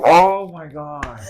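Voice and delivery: loud, drawn-out exasperation; hammed-up fed-up outburst; exasperated. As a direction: let it out long, high and loud. Retelling his exasperation at her hesitation.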